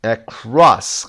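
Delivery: across with CROSS hit hard; stress on second syllable. Shown as a demonstration. Teacher repeating the exercise word, second-syllable stress on across. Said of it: "قسمت دوم".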